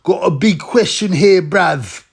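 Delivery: hammed-up London street slang voice; Roadman impression. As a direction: take off a wannabe Roadman host. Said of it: "He talks all like Roadman".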